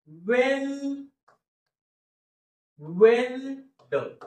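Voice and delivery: वेल held long and high, then weld with ड split off; high, drawn-out sound-out of weld; broken-up slow pronunciation. Shown as a demonstration. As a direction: stretch weld very slowly in parts. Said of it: "फोडून फोडून उच्चार लिहितो आणि हळूहळू".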